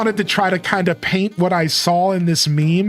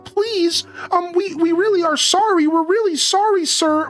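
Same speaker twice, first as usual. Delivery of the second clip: put-on much higher, timid kid voice begging for mercy; pleading little kids; 12 semitones higher than usual. Kids in Rust begging the armed crew, high pleading kid voice. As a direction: play a scared kid saying sorry and pleading. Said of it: "completely different tone".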